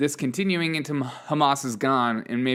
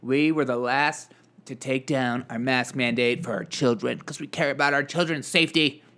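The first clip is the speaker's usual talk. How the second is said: smug state spokesperson. Acted. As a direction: put on a mildly mocking official's voice. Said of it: "we just wanna be the last to say".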